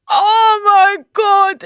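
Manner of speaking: high, loud, drawn-out mock crying; mock wail. Woman FaceTiming her friend afterwards, high melodramatic wail. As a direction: wail it slowly, hamming up the despair. Distraught call to friend, re-enacted.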